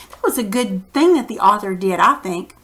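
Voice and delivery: usual speaking voice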